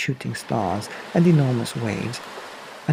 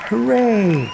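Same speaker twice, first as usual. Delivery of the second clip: higher, louder cheer; villagers cheering; 10 semitones higher, 4 dB louder than usual. Acted. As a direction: crowd cheering the whale off. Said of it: "villagers shouting".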